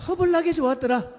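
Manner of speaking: high-pitched Jeolla exclamation; animated Jeolla dialect demo. Shown as a demonstration. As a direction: lift 허블나게 high in Jeolla style. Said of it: "전라도 버전 네 가지나 됩니다".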